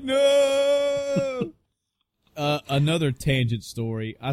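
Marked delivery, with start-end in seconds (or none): 0.0-1.5 high shouted mock protest; mock panicked no. Host acting out Chris's panic, high yelled no. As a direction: yelp it in fake alarm.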